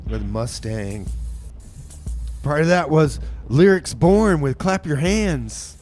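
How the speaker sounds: usual speaking voice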